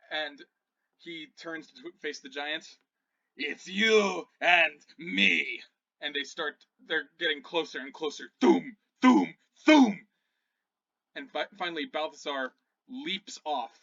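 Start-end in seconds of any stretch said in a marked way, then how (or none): 3.4-5.6 slow, drawn-out showdown line; character's challenge. Acted. Game master as Balthazar facing the giant, slow challenge. 8.4-10.0 spaced, booming thooms; heavy vocal thuds, higher and louder; imitated thunderous footsteps. Game master imitating the giants closing in, booming sound effect.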